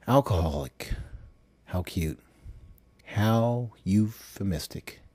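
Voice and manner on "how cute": ordinary delivery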